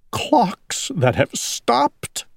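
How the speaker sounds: slow, high tearful lament; mournful and drawn out; crying. A narrator's reading. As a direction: draw it out in a weepy owl voice. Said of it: "Owl was crying".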